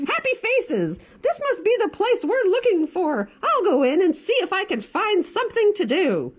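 high squawky hen voice. Acted. Bored hen announcing she's off to town, squawking.

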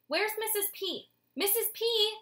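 very high urgent call; mother's high, urgent call; urgently. Acted. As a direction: call for the nurse in a hurry. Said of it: "his mother said urgently".

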